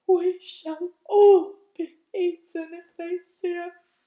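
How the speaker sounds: high, fearful earthworm warning; frightened earthworm. Acted. Earthworm warning the others, high and fearful. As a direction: panic at being seen and eaten. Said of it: "the earthworm whispered".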